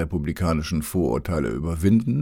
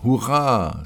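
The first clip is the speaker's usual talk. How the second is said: cheering; 5 semitones higher, 4 dB louder than usual. A narrator's reading. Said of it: "Die Leute riefen".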